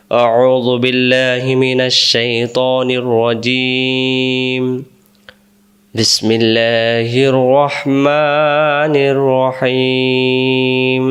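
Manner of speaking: very slow, drawn-out chanted recitation; slow devotional Arabic tilawah; Quranic recitation. Acted. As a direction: recite it with reverence, each word stretched. Opens class with Quran recitation.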